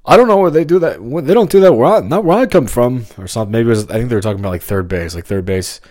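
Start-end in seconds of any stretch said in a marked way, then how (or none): 0.0-3.0 high, loud indignant sitcom protest; worked-up mock complaint; George. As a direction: protest it loudly, voice jumping up. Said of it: "George says something like".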